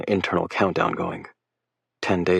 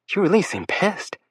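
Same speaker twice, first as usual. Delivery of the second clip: teasing female voice; 12 semitones higher than usual. A narrator's reading. Lake, teasing.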